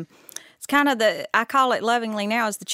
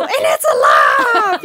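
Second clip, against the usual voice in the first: much higher, louder slow shriek; alarmed shout; 14 semitones higher, 9 dB louder, slower than usual. Retold or imitated. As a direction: shriek out the horror. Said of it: "And then I go".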